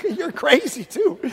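amused mock disbelief; laughing disbelief. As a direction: laugh at the absurd claim. Joseph mocking Mary.

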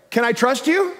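"Can I trust you" pitched high, rising on "you"; questioning. Retold or imitated. Climber at the top asks belayer, questioning. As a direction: lift "you" into an open question. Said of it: "it's actually a question".

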